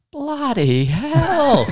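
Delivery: very high, loud, slow Aussie "Bloody hell"; big exclamation. Retold or imitated. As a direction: drag it out, loud and shocked. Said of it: "I just hear this big".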